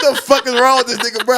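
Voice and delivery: high, laughing mock outrage; mock indignation through laughter. Retelling the coworker's joke to a friend, laughing.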